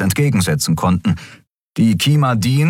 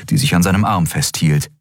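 plain reading voice

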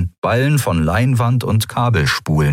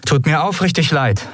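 lying apology; 9 semitones higher than usual. Acted. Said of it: "log sie".

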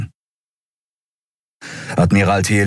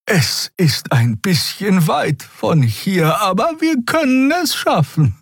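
hesitant hope; 10 semitones higher than usual. Acted. Xea tells the crew of a refuge, high and hesitant. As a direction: offer the idea carefully.

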